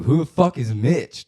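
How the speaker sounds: loud, high, drawn-out retold question; hammed-up freaked-out yell; alarmed demand. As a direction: shout it slowly in mock disbelief.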